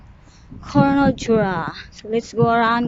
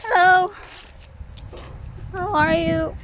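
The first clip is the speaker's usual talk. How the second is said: ordinary delivery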